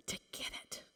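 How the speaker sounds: conspiratorial whisper at the mic; mock-Biden whisper. Real, not acted. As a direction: whisper it like breaking a secret. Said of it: "I'm whispering like Biden".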